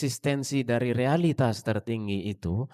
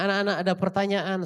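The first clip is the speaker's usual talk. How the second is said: teacher asking class; 8 semitones higher, faster than usual. Retold or imitated.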